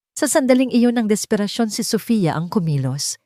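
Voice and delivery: usual speaking voice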